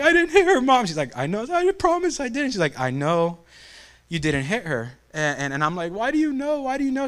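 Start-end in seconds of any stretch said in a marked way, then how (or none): 0.0-0.8 high-pitched mock tearful whine; hammed-up whimpering denial, pitched up; crying kid. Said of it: "I'm, like, crying, sobbing". 1.2-2.5 high-pitched mock tearful pleading; hammed-up sobbing promise; crying kid. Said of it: "I'm, like, crying, sobbing". 2.8-4.9 soft, soothing mother voice; gentle imitation of a calming mom. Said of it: "She tells me to calm down". 5.9-7.1 high-pitched mock weepy question; hammed-up tearful whine; crying kid. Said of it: "I'm, like, crying, sobbing".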